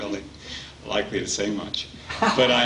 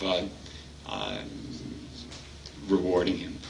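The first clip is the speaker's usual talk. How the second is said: ordinary delivery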